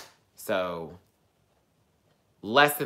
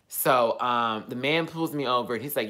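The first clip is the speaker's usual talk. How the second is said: usual speaking voice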